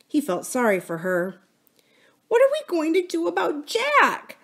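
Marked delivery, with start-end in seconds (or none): 2.3-4.4 put-on high little-girl voice, worried; a small girl fighting back fear; high, worried child's voice with a gulp; anxious little girl. A narrator's reading. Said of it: "with a gulp".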